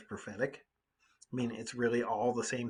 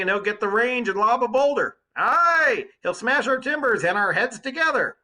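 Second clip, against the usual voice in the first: protesting crew; 8 semitones higher, 7 dB louder than usual. Acted. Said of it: "I heard the crew in low voices protesting".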